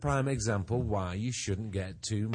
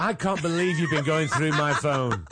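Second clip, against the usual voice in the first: louder, higher, slow hammed-up indignation; mock outrage; 5 semitones higher, 7 dB louder, slower than usual. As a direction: stretch out the fake outrage. Role-play boyfriend turning tables.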